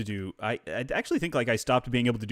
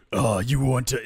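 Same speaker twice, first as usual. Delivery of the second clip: villain voice; 3 semitones higher, 6 dB louder, slower than usual. Retold or imitated. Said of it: "you just do your normal villain voice".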